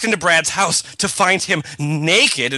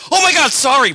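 startled; 13 semitones higher, 5 dB louder, faster than usual. Acted. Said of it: "Brad said".

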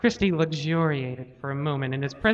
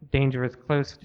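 plain reading voice

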